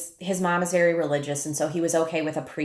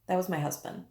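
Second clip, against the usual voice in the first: That was my husband said much softer; softer repeat; 7 dB quieter than usual. Real, not acted. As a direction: drop the repeat right down, falling. Softer repeat of closing line.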